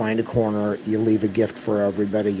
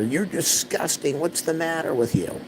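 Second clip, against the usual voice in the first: mocking mom voice; 7 semitones higher than usual. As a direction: mimic a disgusted mother, pitched up. Voicing mom's scolding in story.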